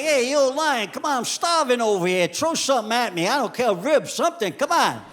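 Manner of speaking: high, loud, pushy street-guy voice; guinea fowl with attitude. Acted. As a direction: heckle the lion for scraps, loud and cocky. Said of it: "And he had an attitude".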